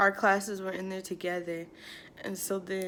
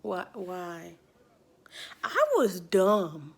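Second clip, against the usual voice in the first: usual speaking voice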